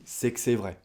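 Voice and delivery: normal talking voice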